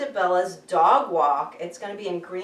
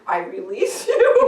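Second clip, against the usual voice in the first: slow, laughing release; 8 dB louder, slower than usual. Retold or imitated. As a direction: laugh through the words. Freeing the cat, laughing.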